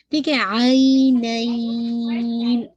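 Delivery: आई नाइन with the नून held clear at the end; clear noon at the stop. Shown as a demonstration. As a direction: recite slowly, ring out the final नून. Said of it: "नून को last में वाजे करना है". (आख़िर में नून साफ़)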